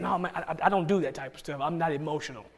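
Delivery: low, subdued refusal; quiet, unemotional. Acted. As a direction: mumble it as the reserved churchgoer. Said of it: "They're quiet".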